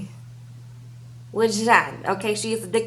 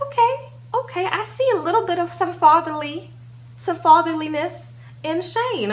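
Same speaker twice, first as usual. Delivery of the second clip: high excited reaction; 7 semitones higher than usual. Retold or imitated. Own reaction retold, high squeal.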